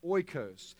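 oikos said OY-kohs, stress on the first syllable; Greek word oikos. Shown as a demonstration. Preacher teaching the congregation the Greek word, careful OY-kohs. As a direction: say oikos as OY-kohs, slow and clear. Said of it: "It's O I K O S".

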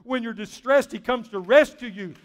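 high, loud, driving preacher delivery; full-force preaching. Real, not acted. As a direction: build to a ringing high pitch, then stretch out the last words.

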